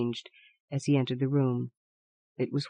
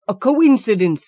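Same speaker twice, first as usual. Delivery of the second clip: loud, high, stammered; stammering; 6 semitones higher, 8 dB louder than usual. A narrator's reading. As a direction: stammer it out in fear. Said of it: "stammered the old man".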